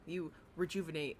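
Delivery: ordinary delivery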